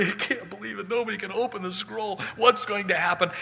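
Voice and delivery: small, high, hushed worried voice; John's distraught disbelief. Acted. John alone as no one opens the scroll, high fretting voice. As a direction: fret under your breath, pitched high. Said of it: "John is just standing there. He's just going".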